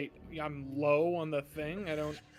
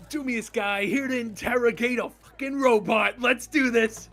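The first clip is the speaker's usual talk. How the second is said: loud, high-pitched bravado; hammed-up hero shout; 5 semitones higher, 8 dB louder than usual. Hero arrives to interrogate a robot, hammed-up shouting. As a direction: holler it like a brash action hero. Acted entrance as brash interrogator.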